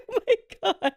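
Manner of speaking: high, laughing oh my God; laughing gasp. Retold or imitated. Reacting to ruining fiance's shirt, laughing. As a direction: squeal it through laughter. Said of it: "I was like".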